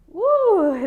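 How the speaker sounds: sudden high, loud whoop of effort; exertion whoop. Real, not acted. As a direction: let out a burst of strain mid-hold. Straining in hardest plank hold.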